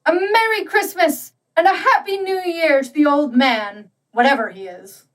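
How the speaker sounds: high, ringing festive call; cheerful cry. A narrator's reading. The company toasting absent Scrooge with a cry. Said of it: "they cried".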